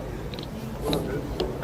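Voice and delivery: unvoiced hushed greeting; whispered. Real, not acted.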